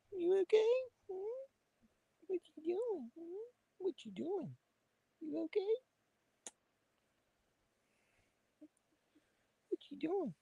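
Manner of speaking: high soft cooing pet voice; sweet high puppy talk. Real, not acted. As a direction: coax the frightened dog gently in a squeaky voice. Coaxing a scared dog.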